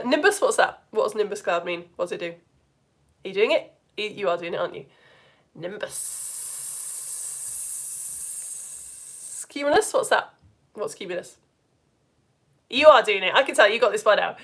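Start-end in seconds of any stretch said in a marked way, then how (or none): 5.5-9.4 hissed whisper. Shown as a demonstration. Teacher acting out a nimbus cloud, long hissing whisper.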